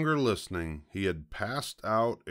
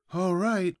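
high, falling piglet voice; sighing piglet; 12 semitones higher, 4 dB louder than usual. A narrator's reading. Piglet told to stay still, sighing high. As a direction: agree with a high-pitched sigh. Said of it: "sighed the pig".

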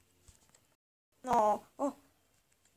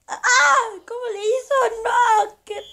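much higher, much louder cry with a laugh; anguished scream; 10 semitones higher, 8 dB louder than usual. Real, not acted. Gamer screaming as the opponent scores, anguished scream. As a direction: wail it in laughing despair.

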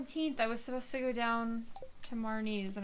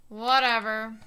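shouted out, fed up; loud whatever; 12 dB louder, slower than usual. Real, not acted. Gamer yelling at her own game, loud. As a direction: throw the word out loud and exasperated.

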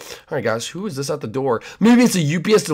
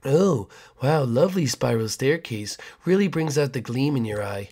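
creepy neighbor; 4 dB quieter than usual. Acted.

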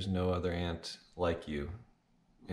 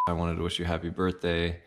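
ordinary delivery

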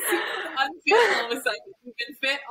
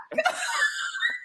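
laugh. Real, not acted. Listener laughs at her own story.

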